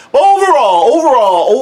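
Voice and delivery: loud, high overall with a stretched opening; a mock fanfare on the first overall; emphatic overall. Real, not acted. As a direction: announce the verdict with a big, high push. Emphatic restart before verdict.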